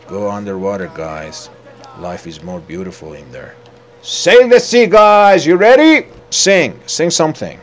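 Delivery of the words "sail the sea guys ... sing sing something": high, very loud rallying call; rally the crew, shouting; captain's shouted orders. Pretend.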